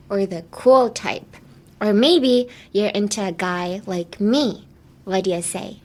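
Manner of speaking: slow, evenly spaced phrases for learners; slow demonstration. Shown as a demonstration. Teacher modelling the phrase for students to repeat, slowly. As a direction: say it slowly, leaving room to repeat. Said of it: "I'm gonna say it slowly".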